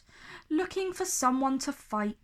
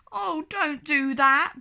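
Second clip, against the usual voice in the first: begging; 5 semitones higher, 4 dB louder than usual. A narrator's reading. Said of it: "begged Norman".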